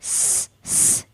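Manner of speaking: स as a soft whistling hiss; स, स drawn out sharp and hissing; whistling seen. Shown as a demonstration. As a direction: hiss स like a whistle through the teeth. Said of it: "सीटी की आवाज़ इस पे आना चाहिए".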